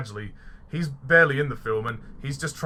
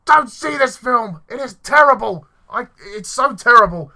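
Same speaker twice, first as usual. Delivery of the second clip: dramatic warning shout; 9 semitones higher, 12 dB louder than usual. Acted. Dramatic opening warning to viewers.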